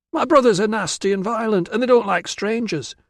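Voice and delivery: put-on high, insistent girlish voice; anxious warning. Virana warns Tilla about her brothers, anxious protest. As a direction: warn urgently, pitched well up.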